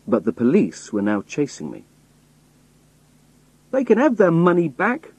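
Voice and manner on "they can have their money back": high, loud exasperated cry. A narrator's reading. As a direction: throw the money back, fed up. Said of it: "I cried".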